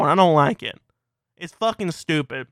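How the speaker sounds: ordinary talk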